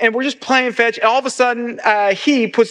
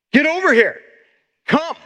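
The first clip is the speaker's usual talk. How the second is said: higher, firm mock command; 5 semitones higher than usual. Upset owner ordering his puppy over, commanding. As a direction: order the puppy to come, half-hearted sternness. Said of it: "I'm commanding him to come".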